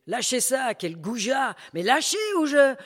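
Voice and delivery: high, loud and furious; outraged protest. Acted. Woman shouting at the thug grabbing her bag, angry. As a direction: shout him off your bag. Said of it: "La prostituée".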